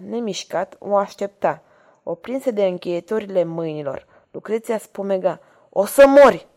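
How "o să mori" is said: loud, high death threat yelled out; furious howl. A narrator's reading. Lucreția lunging at Ragastă with a dagger, howling. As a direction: howl it suddenly, well above the narration. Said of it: "urlă ea".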